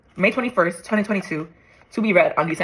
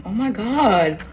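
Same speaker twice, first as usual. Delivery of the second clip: slow, drawn-out, higher exclamation; awed disbelief; 4 semitones higher, much slower than usual. Real, not acted. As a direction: stretch it out in amazement. Moved again by the letter.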